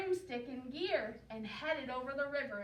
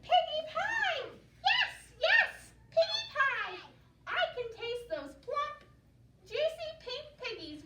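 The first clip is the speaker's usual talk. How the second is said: high, loud cackling glee over piggy pie; gleeful witch shriek; 10 semitones higher, 6 dB louder than usual. Acted. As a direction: squeal it out like a greedy witch.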